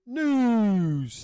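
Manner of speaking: high, loud, drawn-out call; segment announcement. Acted. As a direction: sing out the word like a jingle. Announces the news segment on cue.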